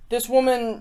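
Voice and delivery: ordinary delivery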